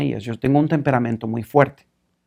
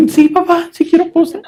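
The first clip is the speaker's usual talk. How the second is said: put-on very high, louder child's voice; hammed-up timid boy; nervous little boy; 5 dB louder than usual. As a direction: squeak it like a scared kid. Boy answering father.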